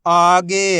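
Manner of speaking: whole word in one joined, drawn-out piece; teacher's blended model reading; syllables blended. Shown as a demonstration. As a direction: join the sounds into one loud, clear word. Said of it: "आपस में मिला के पढ़ना है".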